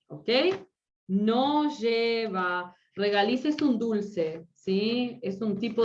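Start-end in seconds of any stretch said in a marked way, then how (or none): 1.1-2.8 very slow, stretched-out "No lleva"; slow dictation. Shown as a demonstration. Teacher giving students the answer on regaliz, slow dictation. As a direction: draw out each syllable of "No lleva".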